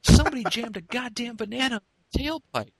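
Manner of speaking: high-pitched incredulous complaint; acted indignant holler, high; outraged disbelief. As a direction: imitate a guy indignantly announcing a prank. Acts the victim's outrage.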